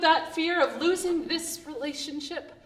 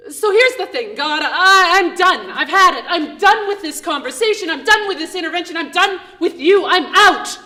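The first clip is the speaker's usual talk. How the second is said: fed-up outburst; 3 semitones higher, 7 dB louder than usual. Acted. Angry ultimatum to God.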